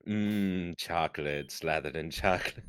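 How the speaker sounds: low, soft, indulgent murmur; a soft mock-sultry chocolate croon; low savouring murmur. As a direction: savour each word like a treat.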